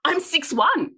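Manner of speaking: I'm six one, high and fed up; exasperated. Retold or imitated. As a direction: repeat the fact in exasperation. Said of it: "I went".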